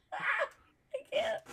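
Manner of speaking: high squeaky laughing; crying with laughter. Real, not acted. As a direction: laugh so hard it almost cries. Helpless laughter at the joke.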